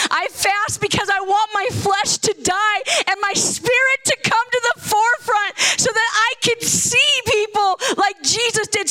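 usual speaking voice

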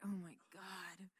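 soft, slow, weary groan; exasperated brothers. Acted. David's brothers groan at him, soft and weary. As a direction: roll your eyes and sigh it out. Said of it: "his brothers are like".

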